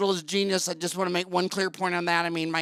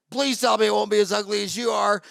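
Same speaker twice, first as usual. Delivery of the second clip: mock pleading patient; 4 semitones higher, 4 dB louder, faster than usual. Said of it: "she's all like".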